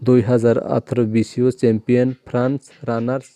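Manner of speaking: ordinary talk, nothing marked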